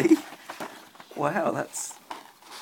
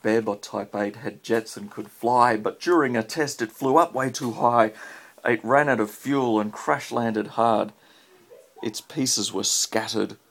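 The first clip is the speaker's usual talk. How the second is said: rhythmic storybook read-aloud; reading to a child; 3 semitones lower, 7 dB louder than usual. Acted. Parent reads rhyming robot book to child, lilting read-aloud. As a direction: read the rhyme to a little one at bedtime.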